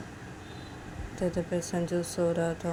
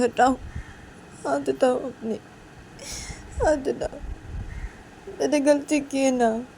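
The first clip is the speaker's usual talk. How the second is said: higher, louder, hurt reproach; upset Uma; 7 semitones higher, 7 dB louder than usual. Acted. Uma telling Sanju to take his hand away, hurt. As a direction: push away with wounded insistence.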